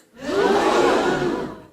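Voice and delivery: loud high gasp; shocked gasp. Retold or imitated. Loud gasp at being called a snake. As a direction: gasp in outrage.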